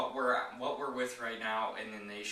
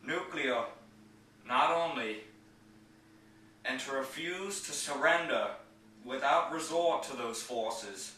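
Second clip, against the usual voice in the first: raised, ringing presidential oratory in measured phrases; higher, louder, declamatory podium delivery; JFK impersonation; 3 semitones higher, 5 dB louder than usual. Acted. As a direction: give a famous speech in an imitated statesman's voice, loud and emphatic, pausing between phrases. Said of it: "going to do my J F K impersonation".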